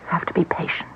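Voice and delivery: breathy hush, no voice; whisper. Acted. Hostess whispering to her guests at the seance table. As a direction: whisper it so as not to break the spell.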